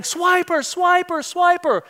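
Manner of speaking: put-on kids yelling. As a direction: yell it like kids at the TV. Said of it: "the kids start yelling".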